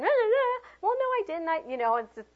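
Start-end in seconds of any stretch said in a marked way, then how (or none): 0.0-0.6 high, whiny mimicry of a spouse; mocking imitation. Acted spouse mimicking the other's tone, mocking. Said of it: "You went". 0.8-1.6 high, protesting denial; defensive. Acted. Teacher acting out a spouse's denial, defensive.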